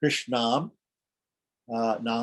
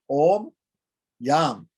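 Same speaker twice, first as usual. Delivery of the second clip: intoned mantra; 3 semitones higher, 4 dB louder than usual. Shown as a demonstration. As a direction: sing out the Om. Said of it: "recites the mantra".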